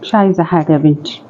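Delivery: resigned sadness. Acted. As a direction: give up and sigh it out. Resigned old woman.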